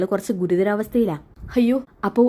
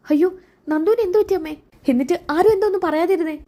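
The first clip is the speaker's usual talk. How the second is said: desperate to know she is alive; panicked; 9 semitones higher than usual. Acted. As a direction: son pressing his mother in panic. Son panics over accident news.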